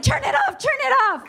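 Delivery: high-pitched urgent outburst; panicked order. Retold or imitated. Customs lady dropping the buzzing toy, high mock panic.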